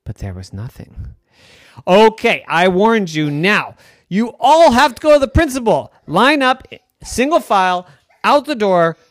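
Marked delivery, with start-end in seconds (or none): 1.9-9.0 loud, high commanding teacher; bossy teacher orders. Acted. Girl orders class to principal, loud and bossy. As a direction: order them out the door.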